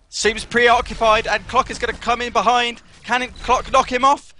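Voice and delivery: high, rising-tension esports commentary; tense play-by-play. Real, not acted. Commentator calls Clock coming in behind, tense high play-by-play. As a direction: call the ambush in a high, urgent voice.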